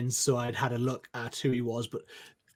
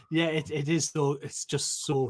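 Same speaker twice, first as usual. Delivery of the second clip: ordinary talk, nothing marked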